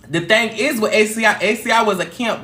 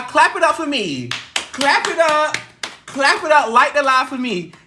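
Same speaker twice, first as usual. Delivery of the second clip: hype; 6 semitones higher than usual. Real, not acted. Asking viewers to applaud.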